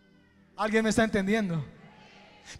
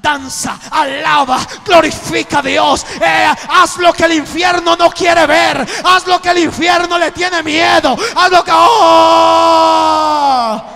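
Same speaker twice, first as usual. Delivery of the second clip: loud, pounding pulpit climax; shouted preaching; 7 semitones higher, 13 dB louder than usual. Acted. Preacher shouting God's command to praise, loud. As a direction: roar it over the music.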